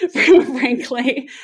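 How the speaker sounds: breaking into a real laugh, pitch jumping up. Real, not acted. Founder defending her favorite color, laughing. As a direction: get the word out through laughter. Bursts out laughing at own answer.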